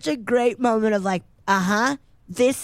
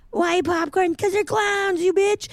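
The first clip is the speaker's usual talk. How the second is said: very high, sneering comeback; mocking clown retort; 11 semitones higher than usual. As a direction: taunt her in a shrill clown voice. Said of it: "Debbie and Mike are running".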